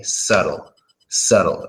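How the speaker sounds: subtle with a silent B, SUT-el; correct pronunciation. Shown as a demonstration. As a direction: drop the B in subtle. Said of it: "Don't pronounce the B".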